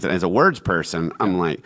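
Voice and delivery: ordinary delivery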